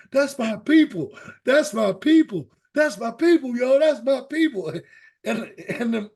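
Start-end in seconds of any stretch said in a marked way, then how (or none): 0.1-4.7 high, loud chest-slapping chant; punk kid's boast. Retold or imitated. Punk kid at the chimp window, imitated boasting. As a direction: show off like a cocky punk kid. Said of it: "slapping his chest, going".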